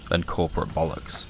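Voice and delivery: usual speaking voice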